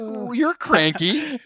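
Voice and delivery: high, drawn-out teasing reaction; playful sing-out of mock offence; mock affronted. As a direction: stretch it out high, laughing at the grump. Mock reaction to star's curt correction.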